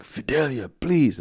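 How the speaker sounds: high-pitched begging husband; imitated pleading. Dad begging mom to stay calm, imitated pleading. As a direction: implore her, pitch lifted.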